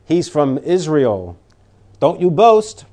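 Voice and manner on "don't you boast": usual speaking voice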